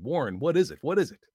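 loud, high panicked questions; terrified. A narrator's reading. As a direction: demand an answer in terror. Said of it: "Terrified, I continued to repeat".

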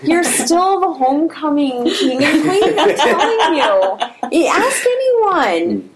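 laughing. Real, not acted. Teasing compliment through laughter.

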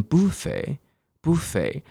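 "buffet" as BOO-fay, front stressed; alternative British pronunciation. Shown as a demonstration. Teacher offering the other British form, "buffet" as BOO-fay. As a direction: slowly say "buffet" with an oo first syllable, BOO-fay. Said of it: "或者念".

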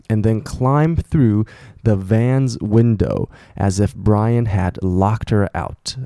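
慢慢地逐字朗读; 放慢速度读新闻原文给学生听; slow reading. Shown as a demonstration. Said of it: "reads the news text slowly". (老师放慢速度给学生念新闻句子)

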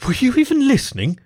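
high-pitched snapping retort; sharp retort. A narrator's reading. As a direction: shoot the question back sharply. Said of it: "she shot back".

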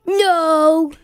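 loud put-on silly monster voice; grumpy guitar voice. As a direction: bark it out as a cranky guitar. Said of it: "Guitars, are you excited".

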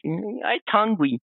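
high, slow little-child whimper, played cute; imitating hungry little girl. Starving orphan eyeing the sculptor's rice ball, small pleading voice. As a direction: plead like a tiny hungry kid.

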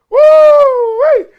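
very high, loud whoop; thrilled holler at the car stereo; excited shout. Real, not acted. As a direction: whoop like the bass just hit you. Hearing the car's sound system.

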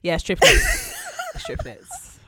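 high laughing voice breaking into giggles; giggly squeaky correction. Real, not acted. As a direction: laugh through the words. Laughing at the mix-up.

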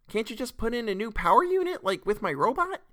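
put-on high-pitched kid voice; high, pleading child character; little child asking. A narrator's reading. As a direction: ask it like a hopeful small child. Child character asks father.

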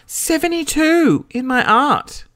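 high, slow, drawn-out protest; outraged disbelief. Real, not acted. Reader offended by their favourite subject's mark, high outraged disbelief.

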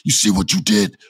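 high, heated accusatory yell; trash talk. Retold or imitated. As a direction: imitate an angry wrestler berating his rival. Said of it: "talking trash to Kevin Owens".

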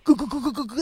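rapid, loud cooing babble; imitated goo-goo noise. Imitating the pig having its tummy rubbed, playful cooing.

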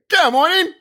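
put-on high, loud English-accented cry; yelling. Joking he'd shout it everywhere, yelling. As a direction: belt it out in mock English. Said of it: "I would be yelling".

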